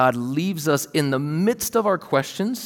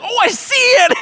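shrill high, loud shout of discovery; excited child; 11 dB louder than usual. Retold or imitated. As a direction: squeal it like a thrilled little kid. Little son finally finds star.

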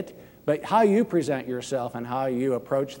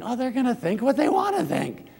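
dismissive thought; 10 semitones higher than usual. Retold or imitated. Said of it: "you might be thinking".

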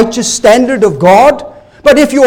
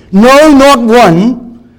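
"No, not one" slowed and stressed; emphatic quote; 3 semitones higher, 5 dB louder, much slower than usual. Shown as a demonstration. Preacher quotes scripture to the church, slow and emphatic. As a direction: drag out "No, not one" for weight. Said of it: "Look how he puts it".

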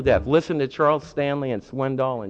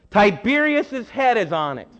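higher, louder call from the crowd; hollered from the crowd; 7 semitones higher, 4 dB louder than usual. Retold or imitated. A man in the crowd hollering the answer to Jesus. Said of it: "I'm sure he didn't say it like that".

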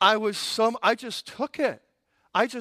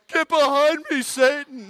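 loud, high rebuke through a laugh; mock rebuke; 8 semitones higher, 7 dB louder than usual. Rebuking the screaming lady like Satan, loud and high. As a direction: cast out the devil, half laughing. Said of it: "And I'm like".

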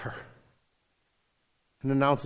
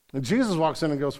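ordinary talk, nothing marked

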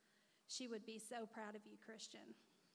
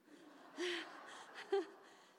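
bright laugh, high and breathy; real laugh; 7 semitones higher, 4 dB louder than usual. Real, not acted. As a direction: laugh at the joke. Joke about brother's room.

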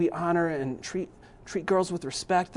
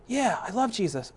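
lightly voiced child, higher pitch; higher, childlike reply; imitating his young son; 7 semitones higher than usual. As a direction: answer a little like an eager small boy. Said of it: "he's like".

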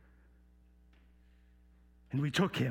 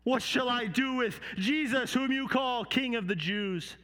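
slow, raised oratory easing into a question; Pilate; 5 semitones higher than usual. Acted. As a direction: proclaim it to the crowd, higher and drawn out. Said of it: "And he said to the people".

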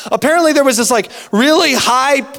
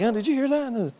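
hushed wondering; 2 semitones higher, 11 dB quieter than usual. Retold or imitated. Spouse asks softly.

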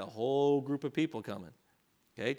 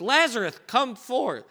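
a loud commanding call, higher pitched; loud cry; 8 semitones higher, 5 dB louder, slower than usual. Acted. Jesus at the grave calling Lazarus forth, loud command. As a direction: call him out of the tomb. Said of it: "he cried with a loud voice".

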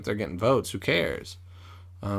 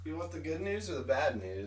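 distant, soft and raised in pitch; off-mic; 5 semitones higher, 11 dB quieter than usual. Real, not acted. Coming back from checking the house, off-mic. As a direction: call it from away from the microphone. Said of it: "I'll do this here".

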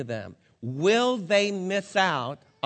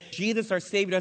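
ordinary talk, nothing marked

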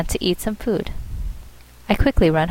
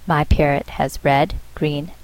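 ordinary delivery

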